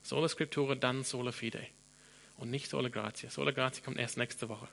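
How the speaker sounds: ordinary talk, nothing marked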